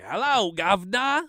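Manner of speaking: loud, high-pitched mock British accent; Graham Elwood's bad accent. Co-host imitating a podcaster's running joke, bad British accent. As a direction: burst into a terrible British accent. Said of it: "how bad his accents are".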